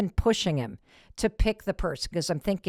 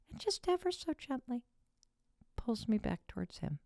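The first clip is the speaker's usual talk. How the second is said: gentle soft; 6 semitones higher, 10 dB quieter than usual. A narrator's reading. Gentle pull, soft narration.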